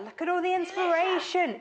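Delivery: high, excited gushing over the book; thrilled, high-pitched exclamation; real delighted admiration. Real, not acted. Admiring the book's pages.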